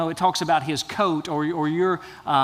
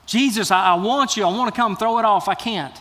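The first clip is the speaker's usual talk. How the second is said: higher, louder, acted inner struggle; anguished pleading; 4 semitones higher, 4 dB louder than usual. Believer to Jesus about letting go, pleading urgently. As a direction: plead as if unable to let go. Voices someone torn, clinging to identity.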